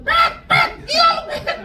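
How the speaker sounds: very high, squealing mock crowd; teman-teman Petrus berteriak. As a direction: call out in a comic falsetto. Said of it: "pada bilang". (seruan teman-teman, suara melengking tinggi)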